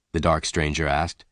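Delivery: usual speaking voice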